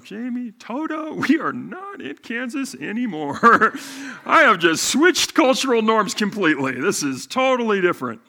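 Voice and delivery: not in Kansas anymore, said with a laugh; amused disbelief. Retold or imitated. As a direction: act out the shock of a new world, chuckling. Retold inner thought, amused shock.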